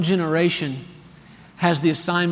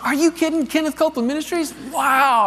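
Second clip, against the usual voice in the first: amazed; 10 semitones higher than usual. Retold or imitated. Phone agent amazed at the name.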